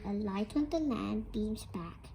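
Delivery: hushed whisper. Acted. Teacher reading to the class, whispering. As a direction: breathe it softly, full of wonder.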